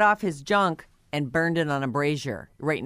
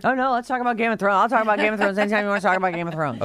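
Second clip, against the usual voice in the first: fast, higher-pitched eager burst; eager rush; 3 semitones higher, much faster than usual. Real, not acted. Co-host jumps at talking about Game of Thrones, eager rush. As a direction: rattle it off excitedly, tumbling over the words.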